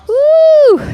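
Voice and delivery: high loud whoop; cheering whoop. Real, not acted. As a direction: whoop to pump up the class. Trainer cheering mid-workout.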